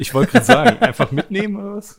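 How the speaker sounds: high, loud disbelief; sudden shocked question; surprised. Real, not acted. Surprised at taking everything.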